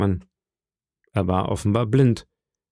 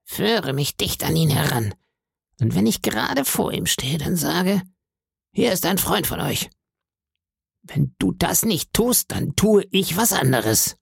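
eerie sing-song beggar; 6 semitones higher than usual. A narrator's reading. Blind beggar begging the innkeeper's boy, high sing-song voice. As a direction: lilt each phrase upward like a pitiful plea. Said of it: "und sagte".

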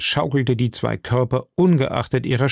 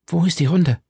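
anxious; 6 semitones higher than usual. Acted. Milady ängstlich.

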